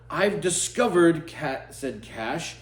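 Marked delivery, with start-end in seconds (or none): 0.1-1.3 higher and louder, a triumphant discovery; proud announcement. Acted. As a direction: announce it like a proud little detective. Cash announces his discovery.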